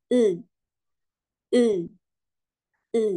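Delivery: "E" as an unrounded uh-like sound, not ee; buzzed vowel, not I. Shown as a demonstration. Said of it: "huruf I bukan bunyi I lagi". ("E" bukan bunyi I, tetapi seperti eu)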